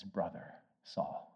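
very soft, slow Brother Saul; tender hush. Acted. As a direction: breathe it out tenderly. Tender embrace in film scene.

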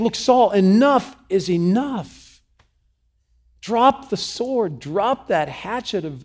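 high, slow, emphatic command; acted fed-up outburst; exasperated demand. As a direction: order him to stop, spacing the words. David demanding Saul stop.

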